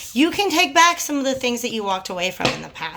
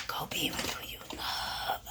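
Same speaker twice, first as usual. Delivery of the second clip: whisper; 17 dB quieter than usual. Real, not acted. Whispered advice to viewers.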